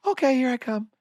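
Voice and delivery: Janet. Retold or imitated. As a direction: turn suddenly dainty and high for the cameras. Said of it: "Janet's talking like this".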